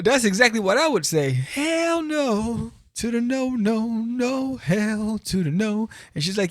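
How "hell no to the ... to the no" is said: high, slow put-on sass; sassy refusal. Reviewer camping up a flat refusal, high and slow. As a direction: drag out the no with attitude.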